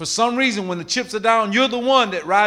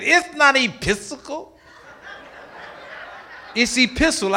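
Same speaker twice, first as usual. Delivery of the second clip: student correcting; 8 semitones higher than usual. Retold or imitated. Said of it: "one of the students said".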